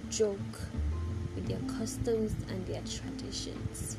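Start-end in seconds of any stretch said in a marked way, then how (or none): none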